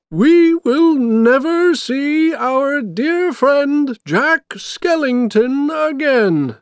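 very high, slow, drawn-out wail; loud, sad proclamation. A narrator's reading. Mayor tells the town of Jack's fall, loud sad wail. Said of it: "He spoke loudly".

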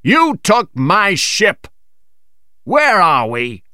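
captain accusing Fogg of stealing his ship; angry accusation. Acted. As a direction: drag out each word in fury. Robbed captain confronts Fogg.

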